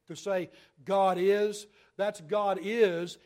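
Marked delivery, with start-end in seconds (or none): 0.9-1.7 GOD IS, loud and punched like an exclamation point; emphatic God is. Shown as a demonstration. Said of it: "emphatically with an exclamation point". 2.2-3.2 GOD IS, loud and punched like an exclamation point; emphatic God is. Shown as a demonstration. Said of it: "emphatically with an exclamation point".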